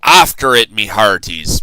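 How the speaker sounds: loud command; pirate command. A narrator's reading. Braidbeard ordering the crew, loud command. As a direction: order the crew after the ball. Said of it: "Braidbeard commanded".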